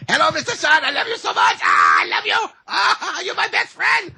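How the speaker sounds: ridiculing caller shouting at the top of his lungs, high mock yell; mock shouting foreigner. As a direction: shriek it like an over-the-top caricature. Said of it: "speak the Chinese language or Mandarin at the top of their lungs".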